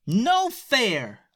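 high, outraged yell; indignant yell. A narrator's reading. Stella protesting the pirates' cannons, yelling. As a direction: cry it out like a wronged kid. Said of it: "yelled Stella".

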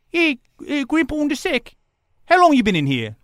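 put-on voice of a questioning guard; jail guard voice. Cop walks over to the prisoners, high put-on voice.

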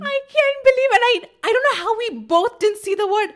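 very high, loud exasperated outburst; real disbelief. Real, not acted. Friend reliving police station blunder, loud disbelief. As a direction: cry out, aghast at your own mistake.

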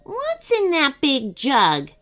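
slow, high, blunt question; abrupt. Acted. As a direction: demand it curtly. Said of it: "asked the cookie abruptly".

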